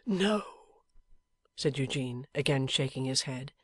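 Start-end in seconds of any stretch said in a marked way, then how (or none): 0.1-0.8 slow, breathless whisper of a wounded man; dying man's whisper. A narrator's reading. Wounded husband whispering to his wife, faint and slow. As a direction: barely get the words out, fading.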